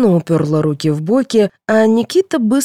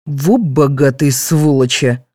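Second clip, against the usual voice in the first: low, slow grumbling outrage; indignant father; 4 semitones lower than usual. A narrator's reading. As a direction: lower the pitch and drag it out indignantly. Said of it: "возмутился Алексей".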